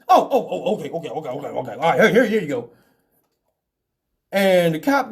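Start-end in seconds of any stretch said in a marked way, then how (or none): 0.1-2.7 flustered. Retold or imitated. Stinger flustered, handing over.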